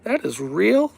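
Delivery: stunned high-pitched shout of amazement; amazed exclamation. Retold or imitated. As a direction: yelp it like you just saw proof. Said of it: "And I said".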